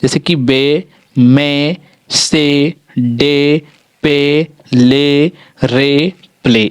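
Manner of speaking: बे, मे, से said with a clear ए sound; teacher drilling example words; model pronunciation. Shown as a demonstration. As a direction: read out each word separately. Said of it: "उसका उच्चारण आप ए कर दीजिएगा".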